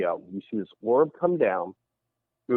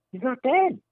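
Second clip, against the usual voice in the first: high-pitched imitation of disbelief; mock-startled denial, pitched high; retold disbelief; 13 semitones higher than usual. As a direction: protest the news in a raised voice. Retold owner denies uncle's death.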